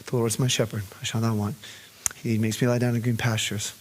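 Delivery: low, soft, tender recitation; soft recited psalm. Retold or imitated. Father soothing daughter with Psalm, soft and tender. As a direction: murmur the psalm over a frightened child. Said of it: "recite Psalm twenty-three over her".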